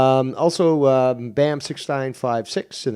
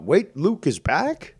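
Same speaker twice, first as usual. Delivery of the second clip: mocking imitation; 6 semitones higher, slower than usual. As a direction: parrot him like he's clueless. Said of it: "He's like".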